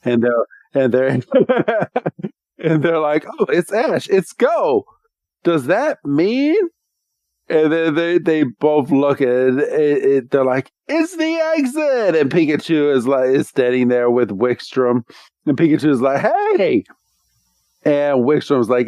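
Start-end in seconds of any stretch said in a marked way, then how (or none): 3.4-6.6 higher, hopeful recognition; mock excitement. Two heroes spotting each other again, mock excitement. 10.9-12.2 high-pitched, hammed-up discovery; mock excitement. Both heroes finding the way out, mock excitement. Heroes spot the exit, acted out. 16.2-16.8 high, drawn-out cartoon greeting; excited Pikachu call. Pikachu greeting the heroes, mock excited call.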